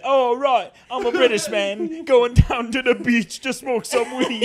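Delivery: loud, high, hammed-up British accent sliding into Jamaican; British turning Jamaican. Shown as a demonstration. Comic showing that any accent winds up Jamaican, bad British-to-Jamaican accent. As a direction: attempt British and land in broad Jamaican. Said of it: "it winds up sounding Jamaican".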